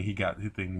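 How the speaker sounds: normal talking voice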